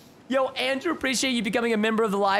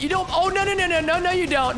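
excited no no no; 8 semitones higher, 3 dB louder than usual. Real, not acted. Stopping enemy from drinking potion.

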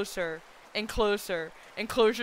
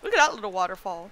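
ordinary talk

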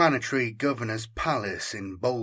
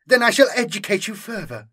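higher, louder and quick, lofty; lightly voiced condescending Inquisitor; haughty inquisitor; 9 semitones higher, 5 dB louder, faster than usual. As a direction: talk down to the officers. Inquisitor lectures officers.

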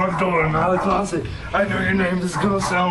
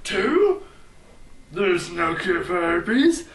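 ordinary delivery

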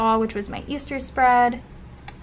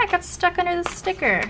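ordinary talk, nothing marked

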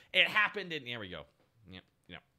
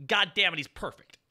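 ordinary talk, nothing marked

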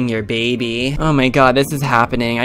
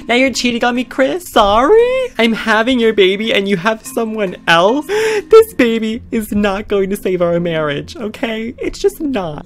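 hammed-up jealous wife; 8 semitones higher than usual. Jealous girlfriend accusing Chris of cheating, high hammed-up whine. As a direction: throw the accusation at him.

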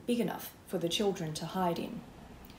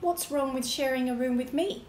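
higher, louder indignant huff; huffy; 6 semitones higher, 4 dB louder than usual. A narrator's reading. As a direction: huff it out, offended. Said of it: "huffed Spencer".

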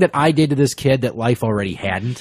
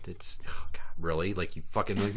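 whisper; 4 semitones lower, 10 dB quieter than usual. Real, not acted. Aside while setting up game.

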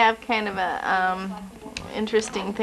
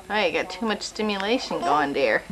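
ordinary talk, nothing marked

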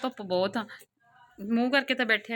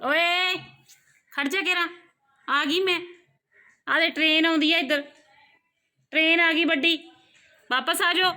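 calling out loudly; 8 semitones higher, 4 dB louder than usual. Real, not acted. Calling after child running off.

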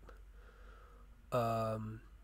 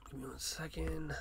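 whisper; 4 semitones higher, 6 dB quieter than usual. Real, not acted. Looking something up mid-review.

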